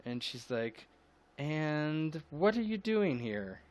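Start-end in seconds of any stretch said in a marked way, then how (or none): 1.4-3.6 higher and slow, drawn out with suspicion; suspicious question. Acted. Matilda spotting John, slow and suspicious. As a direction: eye him up as you ask.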